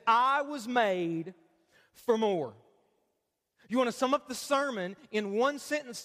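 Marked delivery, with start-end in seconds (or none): 0.1-2.5 each word slow and weighted; slow emphatic statement. Shown as a demonstration. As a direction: read it slowly, like a motto to remember. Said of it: "It says".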